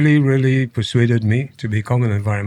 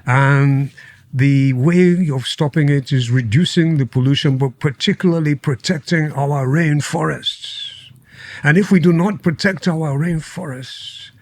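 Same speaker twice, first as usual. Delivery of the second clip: imitated royal British speech-making, pitched up; Prince Charles's accent; 3 semitones higher than usual. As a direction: address world leaders in put-on Queen's English, measured and deliberate. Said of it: "And he said to them".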